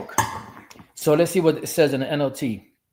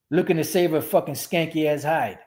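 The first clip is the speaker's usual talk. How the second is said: ordinary talk, nothing marked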